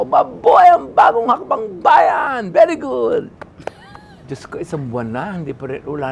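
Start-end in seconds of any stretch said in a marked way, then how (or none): 0.0-3.3 high, loud, slow rallying shout; shouted slogan. Retold or imitated. Actor shouting his one line on set, loud and slow. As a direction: yell the slogan like a rebel soldier. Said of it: "sumigaw ko".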